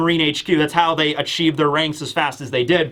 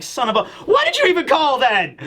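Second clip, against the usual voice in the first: higher-pitched mock exasperation, half laughing; exasperated; 7 semitones higher, faster than usual. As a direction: act out a fed-up officer, exaggerated and high. Acts out exasperated Dahl in retold argument.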